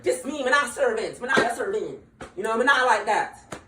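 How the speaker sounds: Jamaican patois, defiant; Jamaican cook. Retold or imitated. Jamaican cook refusing to be a servant, Jamaican accent. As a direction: tell them you're no servant, Jamaican accent.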